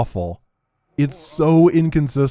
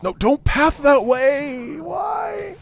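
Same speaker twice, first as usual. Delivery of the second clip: much higher, slow desperate yell at the unit; real frantic shout; much slower than usual. Real, not acted. Unit pathing wrong, yelling.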